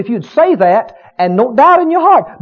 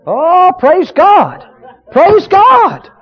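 praise; 7 semitones higher, much slower than usual. Real, not acted. Preacher praising after healing story.

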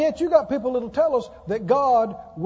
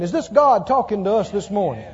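normal talking voice

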